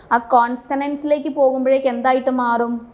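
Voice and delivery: ordinary delivery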